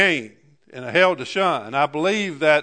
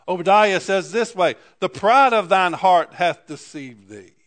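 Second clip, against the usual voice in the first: louder pulpit delivery, scripture declaimed; fervent scripture reading; preaching; 4 semitones higher, 6 dB louder than usual. Acted. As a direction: proclaim it to the church. Preaching builds again.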